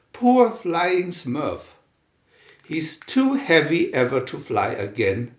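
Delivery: ordinary delivery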